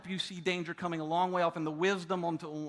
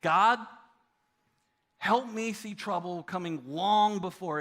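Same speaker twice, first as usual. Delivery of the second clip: prayer voice. Shown as a demonstration. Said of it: "It goes like this".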